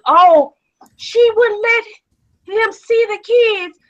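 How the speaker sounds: high, slow mocking whine of the relatives. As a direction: mimic the relatives' lame excuse, high and drawn out. Relatives' excuse mocked in quote.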